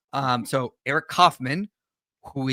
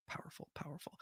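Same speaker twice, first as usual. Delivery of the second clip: barely audible mock-awed whisper; hushed whisper; 25 dB quieter than usual. Real, not acted. Host reacting to a viewer's pun, hushed whisper. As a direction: whisper it under your breath.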